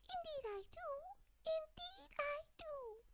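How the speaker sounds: very high prim dormouse; mouse voice. Acted. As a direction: chirp it proudly. Mouse answers.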